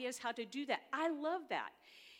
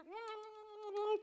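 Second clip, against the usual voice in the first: high bubbling laugh breaking out mid-story; burst of laughter; 12 semitones higher, 3 dB louder than usual. Real, not acted. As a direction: laugh out loud at the memory. Laughing while telling story.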